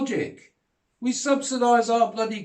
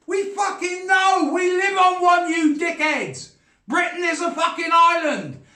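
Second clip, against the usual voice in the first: much higher and louder, yelling in disbelief; furious shout; 10 semitones higher, 3 dB louder than usual. Real, not acted. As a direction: bellow it out, totally fed up. Ranting about Trump's island remark.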